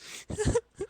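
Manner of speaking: a high, squeaky giggle; a sudden high giggle; high laugh. Acted. Aunt May's friend breaks into a high giggle.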